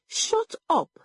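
very high, sharp silencing; yelled command. A narrator's reading. Mother silences her accused daughter, yelling. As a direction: yell it high, without much extra volume. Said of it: "Egodi yelled".

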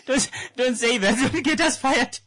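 high, laughing plea; laughing protest. Real, not acted. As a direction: beg them to stop while laughing. Laughing panic.

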